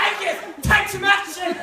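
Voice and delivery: high, loud hammed-up shout of a stern dad; mock dad yell. Imitating dad shouting at son across the table, hammed-up. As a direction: bark the order like a fed-up dad, half laughing. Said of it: "He says".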